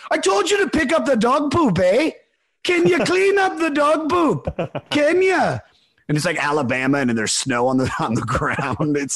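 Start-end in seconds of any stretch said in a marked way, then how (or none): 0.1-5.6 put-on Canadian accent. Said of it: "my Canadian accent's not very good".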